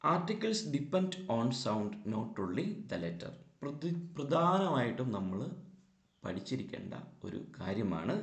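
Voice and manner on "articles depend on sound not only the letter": slow rule statement. Shown as a demonstration. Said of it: "teacher states the rule slowly".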